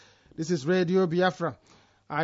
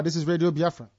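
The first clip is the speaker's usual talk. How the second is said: usual speaking voice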